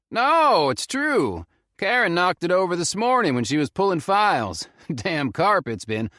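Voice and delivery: Southern ranger stalling. A narrator's reading. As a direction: cover up with forced cheer. Ranger explaining a spill.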